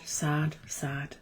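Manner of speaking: fading from soft to a whisper; pitiful fading sad. Acted. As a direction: let the repeated word die away pitifully. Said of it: "And pitifully".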